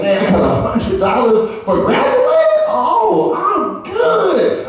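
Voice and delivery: laughing, climbing high and happy; thrilled over a hundred dollars, voice jumping up; gleeful. Retold or imitated. Young grad brags about his gift, laughing and giddy. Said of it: "I was like".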